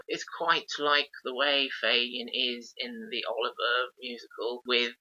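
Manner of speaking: ordinary talk, nothing marked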